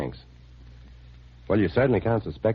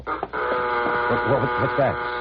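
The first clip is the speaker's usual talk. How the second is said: high, sudden alarm at a noise; startled; 6 semitones higher, much slower than usual. Acted. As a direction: jump at the sound.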